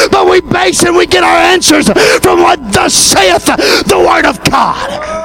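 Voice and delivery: builds to a loud, high preacher's shout, slowing at the end; fiery preaching. Acted. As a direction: preach it out to the whole church at full force. Building into full-force preaching.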